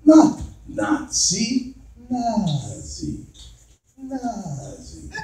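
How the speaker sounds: "Nazi" drawn out very softly, long first vowel; foreign bride's correction. Retold or imitated. Russian wife correcting how her mother's name is said, softly drawn out. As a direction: slowly correct it, hushed and lingering on "Nazi". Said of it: "And she goes to me".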